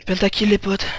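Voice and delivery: loud, high hype announcement; esports caster. Real, not acted. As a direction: call it like a caster. Announcing a pentakill.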